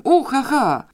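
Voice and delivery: high, loud wild whoop; wild yell. Acted. Father bursts out at his prized reducers, wild high yell. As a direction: whoop like a man gone mad with joy. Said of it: "wrzasnął dzikim głosem".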